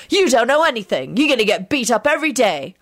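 put-on scornful boyish threat; disgusted. A narrator's reading. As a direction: sneer it as a taunt. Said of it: "the boy replied disgustedly".

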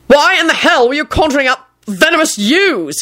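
high outraged demand, little extra volume; shouting. A narrator's reading. Boss demanding answers from Neville, shouting. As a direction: pitch the question up in disbelief. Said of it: "he shouted at Neville".

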